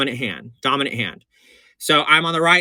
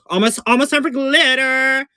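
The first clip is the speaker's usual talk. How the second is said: giddy singsong about glitter; excited singsong; 11 semitones higher than usual. Real, not acted. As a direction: tease the glitter coming up.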